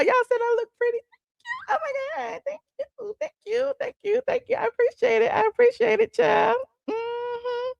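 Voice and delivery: put-on very high, girlish coo; flattered gushing. Streamer answers chat saying she looks pretty, high flattered gushing. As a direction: gush thanks in a squeaky little voice.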